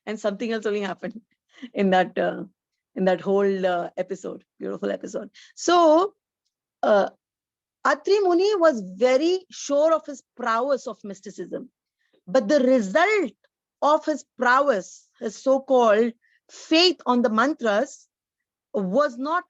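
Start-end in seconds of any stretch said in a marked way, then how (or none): none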